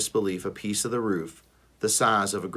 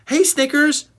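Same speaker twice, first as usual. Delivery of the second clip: high, louder cheerful call; a little kid greeting a friend; child's call; 8 dB louder than usual. A narrator's reading. Young Ruby greeting Snickers, high and cheerful.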